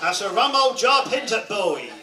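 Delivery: loud, broad Norfolk drawl; Norfolk phrase. Shown as a demonstration. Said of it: "that's Norfolk".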